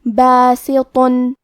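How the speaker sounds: باسطن slow, the ط heavy and full-mouthed, the ب and س thin. Shown as a demonstration. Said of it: "طو کو موٹی آواز میں اور منہ پھلا کے". (ط موٹی آواز میں)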